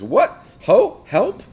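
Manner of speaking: high, loud startled cry for help; a hidden old man suddenly shouting for help; calling for help. Retold or imitated. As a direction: yelp it out from behind the curtain. Polonius crying out from hiding.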